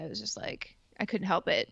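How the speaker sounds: breathy whispered confession; hushed admission she couldn't help it. Retold or imitated. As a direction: whisper it like a secret you can't help. Confessing she was helpless.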